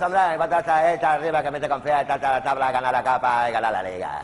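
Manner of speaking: loud mumbling footballer voice, a bit clearer; dim footballer. Acted. Footballer answering the quiz with the same clichés, loud and mumbled. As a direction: rattle off the clichés proudly.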